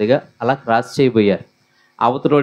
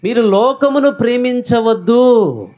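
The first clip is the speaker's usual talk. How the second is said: much higher, driving pulpit voice; full-force preaching; 7 semitones higher than usual. Acted. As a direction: preach it hard to the congregation. (ఉత్సాహంగా బోధిస్తూ)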